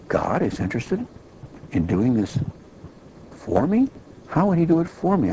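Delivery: a retold jolt of wonder; amazed.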